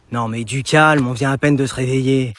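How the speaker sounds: very low, groggy mumble; acted morning grogginess; just woken up. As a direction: protest sleepily in a low voice.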